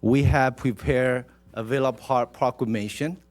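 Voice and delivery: ordinary talk, nothing marked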